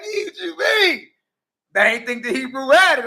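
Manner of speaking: gloating. Retold or imitated. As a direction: rub it in playfully. Gloating after the shot.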